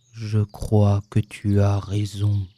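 low, slow, mournful donkey; sighing sadness. A narrator's reading. Donkey admitting the bear is right, deeply sad. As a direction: concede it heavily, deep and drawn out. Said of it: "avec un long soupir de tristesse".

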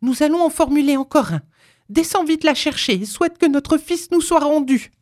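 high, excited, triumphant woman. A narrator's reading. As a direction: urge him on in high exultation. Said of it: "répliqua-t-elle triomphante". (triomphante, exaltée)

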